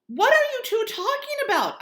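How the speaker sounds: high, loud, strained shout. Acted. Augie shouts at Mom and Via across the table. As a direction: shout the question at them. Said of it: "I shouted".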